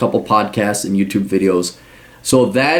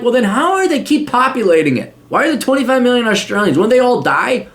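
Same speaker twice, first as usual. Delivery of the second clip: sarcastic retort; 11 semitones higher than usual. Retold or imitated. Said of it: "I'm like".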